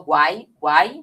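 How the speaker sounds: "guai" glided into a single syllable; "guai" run together like one sound. Shown as a demonstration. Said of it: "a gente pronuncia quase como se fosse uma coisa só".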